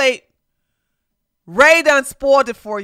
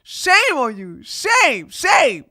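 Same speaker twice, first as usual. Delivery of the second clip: sing-song shame chant, higher; mock shaming; 8 semitones higher, slower than usual. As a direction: wag a finger with each shame.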